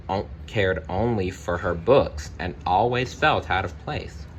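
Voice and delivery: ordinary delivery, nothing marked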